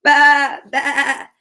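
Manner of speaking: wavering baa; sheep bleat. Acted. Storyteller voicing a sheep for children, bleating. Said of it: "says a sheep".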